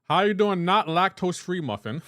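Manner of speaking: excited loud greeting. Real, not acted. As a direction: greet the viewer with big energy. Loud greeting to a chat viewer.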